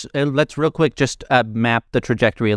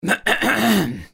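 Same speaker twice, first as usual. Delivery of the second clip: throat clear; 9 semitones higher than usual. Acted. Speaker calls for attention.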